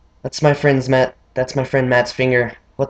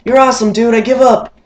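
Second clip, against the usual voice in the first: much higher, strained "you're awesome"; friend conceding; 10 semitones higher, 5 dB louder than usual. Retold or imitated. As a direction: squeak it out in defeat, higher. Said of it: "And he's like".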